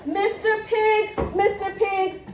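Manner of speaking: high sing-song call at the door; calling out sweetly. Acted. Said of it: "I called".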